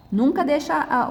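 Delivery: normal talking voice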